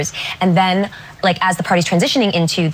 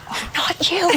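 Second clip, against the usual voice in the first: dismissive aside; 9 semitones higher, 4 dB quieter, slower than usual. Retold or imitated. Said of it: "Daniel goes".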